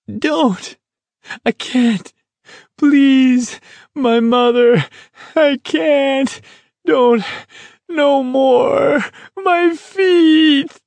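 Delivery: very high, panicked pleading in short bursts; desperate falsetto wails; weeping, screaming. A narrator's reading. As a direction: beg hysterically, building to a shriek. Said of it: "he was weeping".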